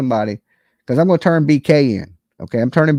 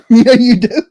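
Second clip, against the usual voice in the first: laughing lawyer voice; 7 semitones higher, 3 dB louder than usual. Retold or imitated. Lawyers mocking client, laughing.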